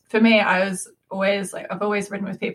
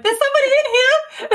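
squeaky high put-on voice; cheer voice; 4 dB louder than usual. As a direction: chirp it out in a bright high voice. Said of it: "That's your cheer voice".

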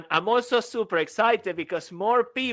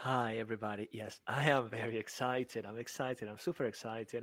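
much softer and lower, mock-bored; deadpan flat excitement; 7 semitones lower, 11 dB quieter than usual. Co-host claiming excitement in a soft, low, mock-bored voice. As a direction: say it flat as a joke. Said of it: "deadpan low before exploding".

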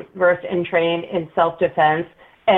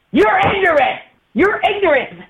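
hammed-up rant, flinging the insult at her twice; mimicking an angry yell; 10 semitones higher than usual. Angry man at the gas station yelling at her, mock yell. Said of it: "the guy won't stop yelling at me".

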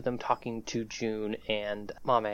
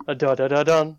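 sung ding-dong of a doorbell; doorbell chime; 7 dB louder than usual. Acted. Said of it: "the doorbell goes".

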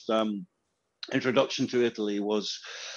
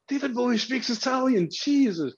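higher, awestruck exclamation; higher, marvelling aside; amazed; 11 semitones higher than usual. Retold or imitated. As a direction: relive a teenager's wonder. Teen amazed at [name] in Italian.

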